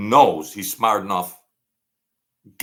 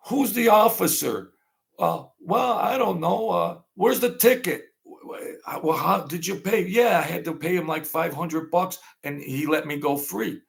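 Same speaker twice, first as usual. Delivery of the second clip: higher, lightly acted voices for the people in the story; voiced characters; 8 semitones higher than usual. Storyteller voicing the kid and uncle, lightly higher. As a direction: play both sides of the exchange a bit up in pitch. Retold exchange between characters.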